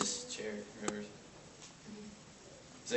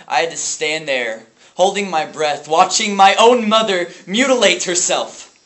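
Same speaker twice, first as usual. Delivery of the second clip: building louder and higher to a near-shout; anguished outburst; 4 semitones higher, 10 dB louder than usual. Acted. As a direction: relive it with mounting horror. Forced to watch mother harm herself.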